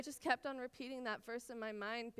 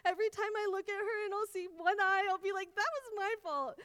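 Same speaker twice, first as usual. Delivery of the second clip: much higher and louder, half-laughing at her own panic; retold panicked wail; 9 semitones higher, 7 dB louder than usual. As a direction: replay the midnight panic over the hurt cat. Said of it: "I was like".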